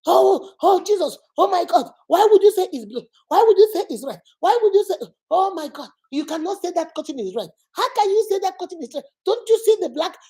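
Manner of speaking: loud, high, outraged disbelief at a spouse; exasperated quarrel. Acted. Spouse arguing over the curtain, loud and high in disbelief. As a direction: protest that the curtain can't be red.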